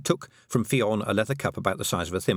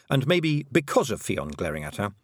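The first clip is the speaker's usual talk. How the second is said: ordinary delivery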